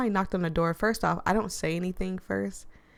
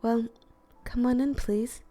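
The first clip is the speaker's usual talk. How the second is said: higher, mellow hostess voice; smooth, mellow Nari; 5 semitones higher than usual. Acted. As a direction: invite them in with a smooth, raised pitch. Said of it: "a smooth, mellow-toned voice".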